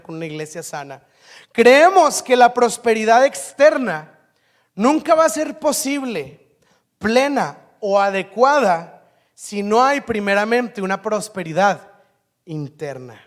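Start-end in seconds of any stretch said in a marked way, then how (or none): 1.5-13.2 slow, loud, weighty pronouncement; emphatic preaching. Acted. Pastor declaring the church's core belief, loud and slow. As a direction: preach it slowly, every word weighty.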